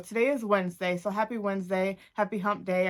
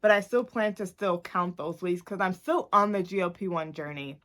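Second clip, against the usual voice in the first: normal talking voice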